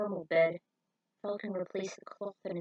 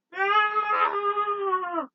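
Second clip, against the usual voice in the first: panicked cry; 12 semitones higher, 5 dB louder than usual. Acted. Rick crashing.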